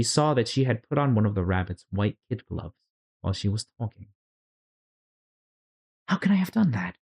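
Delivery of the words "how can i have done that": put-on higher, puzzled young girl's voice; lightly voiced little girl wondering to herself; Alice thinking aloud. A narrator's reading. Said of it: "she thought".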